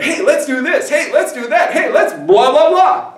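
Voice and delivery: high, singsong pep; eager suggestion. Acted. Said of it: "which are basically saying".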